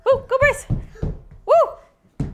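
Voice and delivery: high, loud whoop; excited cheering. Real, not acted. Teacher cheers a child's high knees, excited whooping. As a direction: cheer a runner on.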